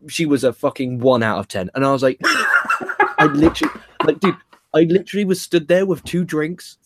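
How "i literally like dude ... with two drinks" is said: laughing through it high; can't stop laughing while telling it. Real, not acted. Laughing as he tells co-host about the date.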